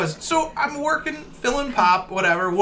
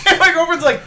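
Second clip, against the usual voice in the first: normal talking voice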